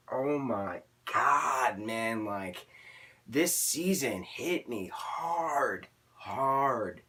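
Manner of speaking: normal talking voice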